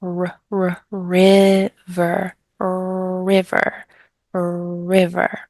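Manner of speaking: river, with a stretched and drawn-out r at the start; teacher drilling the r in river for a student; careful r sound. Shown as a demonstration. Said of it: "no, it's river".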